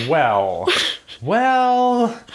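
long, high drawn-out "well"; hedging. Real, not acted. Co-host hedging over a teasing claim, drawn-out and high. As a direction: stretch it out high, stalling for time.

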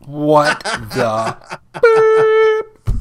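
exaggerated Southern drawl, high, loud and very slow; mock Southern accent. Mocking the show's fake Southern accents in a bad Southern drawl. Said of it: "horrible Southern accents".

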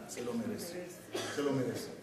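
very soft, low "se lo merece"; tight-fisted father conceding, low and soft; grudging concession. Retold or imitated. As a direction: mutter it as if against his will.

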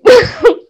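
a burst of high laughter; loud laugh. Real, not acted. Laughing at own story.

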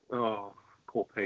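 a drawn-out, softened show of pity; mock sympathy. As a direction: play at feeling sorry, slow and soft. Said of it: "you start going".

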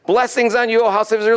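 high-pitched mock proclamation; proclaimed blessing. Soothsayer failing to curse Israel, high proclaiming voice. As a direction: sing out a blessing high, against intent. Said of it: "And he says".

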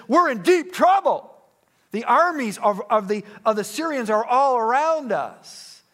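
a frightened servant rushing his plea to the Lord; panicky servant. Retold or imitated. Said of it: "his servant is freaking out".

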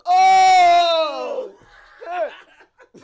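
high loud shout of shock; startled shout. Retold or imitated. Caught off guard by the video, startled shout. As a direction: blurt it out startled. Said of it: "I was like".

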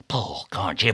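held-back nagging; restrained urging. A narrator's reading. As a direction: urge the rower under your breath. Stubb nagging, before outburst.

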